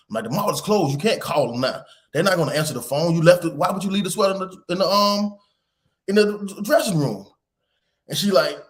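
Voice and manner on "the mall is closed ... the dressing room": raised-pitch, exasperated telling-off; retold rant, piling one complaint on another; scolding dad. Dad tells daughter the mall is closed, scolding.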